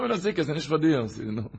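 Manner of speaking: usual speaking voice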